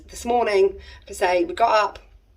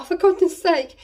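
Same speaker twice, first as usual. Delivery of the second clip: exasperated; 10 semitones higher, 4 dB louder than usual. Retold or imitated. Diesel light comes on while lost.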